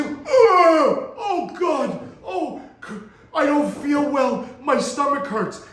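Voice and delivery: high, hammed-up queasy woman with a gag; imitating a woman faking a stomach ache, slowly; mock groaning lady who feels ill; faked sudden sickness. Client's wife suddenly acts sick.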